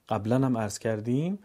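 ordinary delivery

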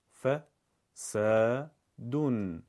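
ح، س، بن split into separate syllables with pauses. Shown as a demonstration. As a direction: spell out ح، س، بن one syllable at a time. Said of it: "بخشخوانی".

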